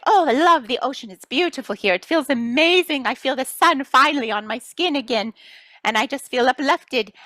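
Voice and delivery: high, bright-sounding rapture about the ocean; high joyful sighs of pleasure; blissful. Pretend. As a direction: gush with delight. Loving the ocean.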